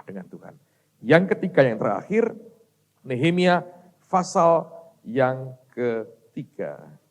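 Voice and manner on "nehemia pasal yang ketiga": word by word, with gaps; drawn-out, separated words; slow, spaced announcement. Real, not acted. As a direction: dictate the reference very slowly. Announces the verse for listeners.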